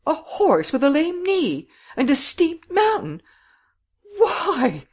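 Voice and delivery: very high, loud protest; acted high, loud indignation; appalled cry. A narrator's reading. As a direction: protest in mounting outrage, pitched right up. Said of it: "cried Miss Cummins".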